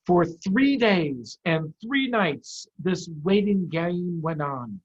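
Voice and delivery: put-on slow, drawn-out reading to children; story narration. A narrator's reading. Teacher reads chapter to class, slow. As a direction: read it with suspense.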